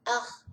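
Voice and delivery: "ar" with a guttural r from the throat; the local throaty "ar"; "ar" com R de garganta. Shown as a demonstration. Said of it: "É mais aqui".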